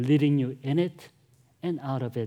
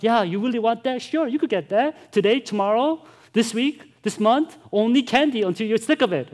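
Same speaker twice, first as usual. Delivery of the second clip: higher, louder mock-generous offer; sarcastic parent; 7 semitones higher, 5 dB louder than usual. Parent teasing a candy-craving child, sarcastic and higher. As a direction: tease the kid with too much candy. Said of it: "And you're like".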